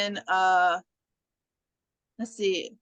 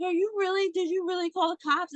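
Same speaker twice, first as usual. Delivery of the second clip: mock worry; 8 semitones higher than usual. Retold worried killer asks about cops.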